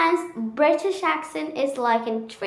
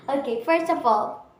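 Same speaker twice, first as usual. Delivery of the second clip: ordinary talk, nothing marked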